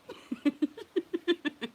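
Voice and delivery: high, loud laugh; real burst of high laughter; cracking up. Real, not acted. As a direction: crack up at your own joke. Laughing at own joke.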